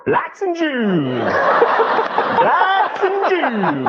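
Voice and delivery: high, slow, drawn-out call; long, loud public bellow; platform holler. Acted. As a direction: stretch it out like a street crier. Imagined calling out on subway platform.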